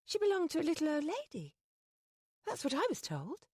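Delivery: put-on young lady apologizing to the duke; Penny's voice. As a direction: apologize in a flurry. Penny protests.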